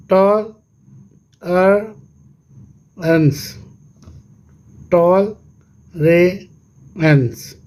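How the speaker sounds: "टॉल, रे, एंस" as three isolated beats; long pauses splitting टॉल, रे and एंस; syllable by syllable. Shown as a demonstration. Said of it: "तो ये कितने syllable है". ("टॉल, रे, एंस" अलग-अलग, रुक-रुककर)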